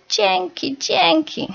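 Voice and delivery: very high squeaky thanks; chicks' happy calls. A narrator's reading. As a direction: chirp it out like tiny chicks. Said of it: "Pisklęta wołały".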